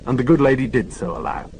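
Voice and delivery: usual speaking voice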